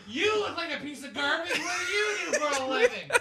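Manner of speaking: high, loud laughing crowd-work; yelling insults at a woman in the crowd; mock insult yell. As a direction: bellow it with a laugh. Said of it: "I say".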